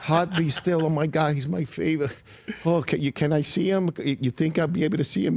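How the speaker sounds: a fan begging to see her favorite star; gushing fan. Retold or imitated. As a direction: play the swooning fan who can't believe it. Waitress gushing over the star.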